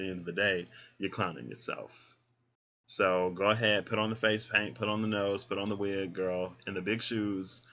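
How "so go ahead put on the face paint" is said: ordinary talk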